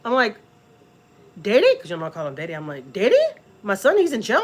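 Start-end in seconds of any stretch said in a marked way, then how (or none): 1.4-1.8 high, loud startled question; incredulous, pitched up and louder; retold disbelief. Reacts to son's arrest news. 2.9-4.4 high, forceful incredulous question; startled, pitched up and louder; retold disbelief. Reacts to son's arrest news.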